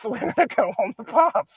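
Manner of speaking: giggling through the posh voice; laughing, can't keep it straight; breaking character giggling. Real, not acted. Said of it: "I can't keep a straight face".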